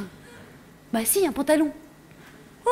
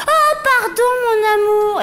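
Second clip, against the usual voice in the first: high, gushing apology; mock-tender mother; 4 semitones higher, slower than usual. Mother coos a fake apology to her daughter, high pitched. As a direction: play the mother simpering sorry.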